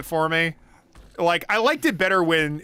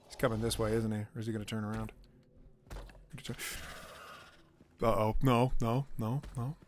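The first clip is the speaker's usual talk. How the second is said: hushed whisper; 7 semitones lower, 11 dB quieter, faster than usual. Real, not acted. Hiding from monster, hushed.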